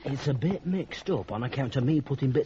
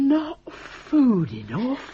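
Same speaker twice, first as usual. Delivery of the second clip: slow, high, disbelieving question; astonished; 8 semitones higher, 6 dB louder, much slower than usual. Acted. Zack aghast at Martha never having food enough. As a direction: draw out the shock slowly. Zack surprised at her hunger.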